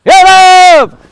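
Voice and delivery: high, loud command; sharp shout. Real, not acted. Owner sending poodle after ducks, loud high shout. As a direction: shout him up out of the down. Flushing dog to action.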